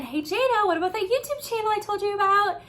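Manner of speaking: a friendly, teasing nudge; gentle reminder. Retold or imitated. God reminds Jada about the YouTube channel: high and light. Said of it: "he reminded me again".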